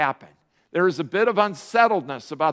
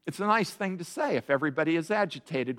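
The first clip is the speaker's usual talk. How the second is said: usual speaking voice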